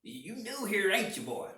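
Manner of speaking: acted owner's voice, lifted high at the start; restaurant owner's challenge. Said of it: "starts talking to us".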